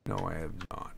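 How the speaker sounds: normal talking voice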